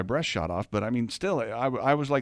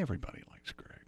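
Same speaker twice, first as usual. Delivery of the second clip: whisper; 14 dB quieter, faster than usual. Real, not acted. Whispered teasing aside.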